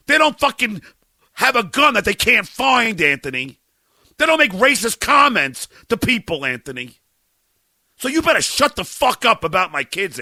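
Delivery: loud, high ranting with each name spat out; furious yelling. Real, not acted. Father yelling at a comic who mocked his children, enraged. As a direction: tear into him, voice at full blast. Raging at Anthony over kids.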